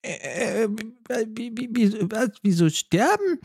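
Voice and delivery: high, stammering baffled raccoon voice; confused raccoon. Acted. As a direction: sputter in high-pitched confusion.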